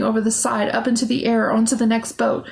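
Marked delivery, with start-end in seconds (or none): none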